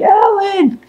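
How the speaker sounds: very high-pitched cry without volume; thin falsetto mock scream, held back. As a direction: imitate a woman screaming a name. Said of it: "And she's screaming".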